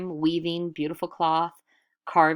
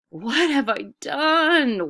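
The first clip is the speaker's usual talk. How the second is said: high, loud, slow wail of dismay; 9 semitones higher, 6 dB louder, slower than usual. A narrator's reading. Adis realising she took too much sky, wailing. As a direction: wail it out in horror at what she did. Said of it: "wailed Adis".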